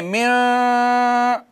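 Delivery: मिन with the n hidden and held one alif long; hidden nasal n. Shown as a demonstration. Teacher demonstrating ikhfa, nasal held long. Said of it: "एक अलिफ के बराबर आवाज छुपाएं".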